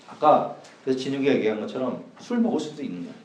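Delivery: usual speaking voice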